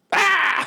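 a sudden shrill-high cry, far above his voice; high monster yell. Acted. GM voicing the hurt snake, a high yelp. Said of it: "And it yells".

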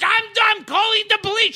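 put-on frantic old boss shouting. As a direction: yell it like a panicked shop owner. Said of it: "And he goes".

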